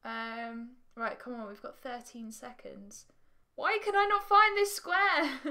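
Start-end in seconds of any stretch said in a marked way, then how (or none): 3.5-5.4 high, loud cry of frustration; exasperated shout. Real, not acted. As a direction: shout in playful desperation at the game. Frustrated at losing the game.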